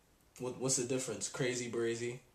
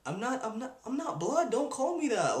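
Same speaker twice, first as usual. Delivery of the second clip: higher, offended protest at a nickname; indignant protest; 8 semitones higher than usual. Retold or imitated. Answering a stranger who calls him blood, indignant and raised. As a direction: push back, insulted, pitch up.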